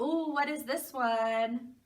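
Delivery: ordinary talk, nothing marked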